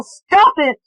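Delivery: high, loud furious shout. A narrator's reading. Eleanor shouting at the voice in the dark, high and loud. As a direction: bark it out to end the haunting. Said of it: "she shouted".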